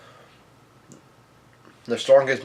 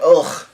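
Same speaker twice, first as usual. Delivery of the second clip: high, loud mock grunt; pitched-up, throaty imitation of being hit; retold yell of pain; 5 semitones higher, 5 dB louder than usual. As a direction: imitate a pained "ugh". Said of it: "he yells out".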